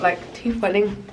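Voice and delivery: usual speaking voice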